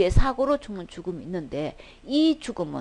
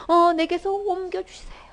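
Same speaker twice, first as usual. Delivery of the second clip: breathy, slow begging whisper, pitched up; anguished whispered plea; 7 semitones higher, 4 dB louder than usual. Retold or imitated. Jesus begging the Father to take the cup, anguished whisper. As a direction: whisper the plea as if too weak to say it aloud. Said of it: "막 이 심정이".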